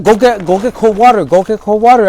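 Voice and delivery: high, loud urgent command; ordering water for an overdosing man. Retold or imitated. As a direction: bark the order in panic.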